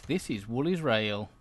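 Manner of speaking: normal talking voice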